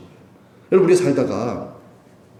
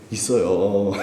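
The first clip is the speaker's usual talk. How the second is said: ordinary delivery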